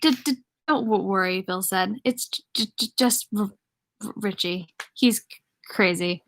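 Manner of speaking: halting stutter on "don't", "just", "Richie", "crazy". Acted. Reader voices Bill's stutter.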